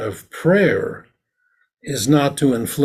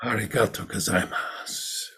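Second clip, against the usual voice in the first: a much softer, gentle arigato gozaimasu; soft-spoken thanks; 3 semitones lower, 9 dB quieter than usual. Shown as a demonstration. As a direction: say arigato gozaimasu quietly and humbly. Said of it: "Or would he say".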